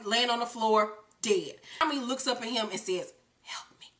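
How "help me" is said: breathless whispered plea. Acted. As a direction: whisper it, terrified. Scared Tommy pleading.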